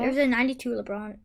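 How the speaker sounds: ordinary talk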